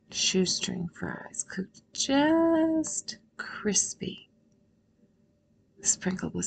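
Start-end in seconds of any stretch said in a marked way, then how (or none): 1.5-4.3 JUST stretched long, high and loud; savouring emphasis. Real, not acted. Savouring imagined fries in meditation.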